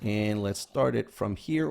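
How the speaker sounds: ordinary talk, nothing marked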